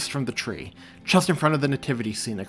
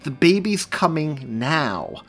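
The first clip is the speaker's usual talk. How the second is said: slow, louder, urgent statement; higher, emphatic insistence; exasperated; 6 semitones higher, 6 dB louder, slower than usual. A narrator's reading. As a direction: spell out the urgency.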